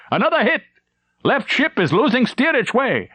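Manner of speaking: high excited report. Acted. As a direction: cry out the good news. Officer calls out in battle.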